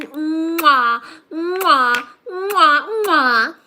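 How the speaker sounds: loud blown kisses; between kisses. Real, not acted. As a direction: blow kisses at the viewer. Blowing kisses to gifter.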